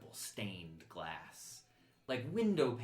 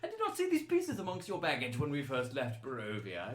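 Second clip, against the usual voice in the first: higher-pitched, gushing aristocrat; effusive courtier; 6 semitones higher than usual. Acted. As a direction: admire their finery as a delighted noble. Game master plays admiring courtier.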